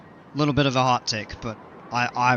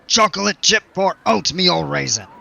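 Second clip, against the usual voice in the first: higher, louder silly pirate; 6 semitones higher, 6 dB louder than usual. Pretend. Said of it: "Now I'm getting real stupid".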